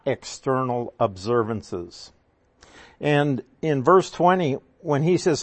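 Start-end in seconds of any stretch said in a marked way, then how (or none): none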